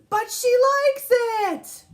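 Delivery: put-on very high, loud, drawn-out kid protest; stretched, high little-boy shout; David's excuse. A narrator's reading. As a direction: wail it like a defensive child. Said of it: "He says".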